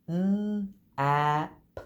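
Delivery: n, a, p said as separate sounds; segmented sounds. Shown as a demonstration. Teacher blending words for the class, sound by sound. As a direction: sound out n, a, p one by one. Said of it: "I'm gonna blend some words".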